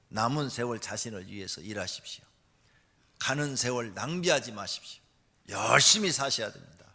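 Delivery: ordinary talk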